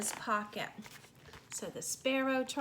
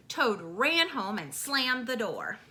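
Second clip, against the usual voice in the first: ordinary delivery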